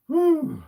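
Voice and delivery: high sharp whistle of breath; relieved whew. Real, not acted. Huge snake lifted onto him.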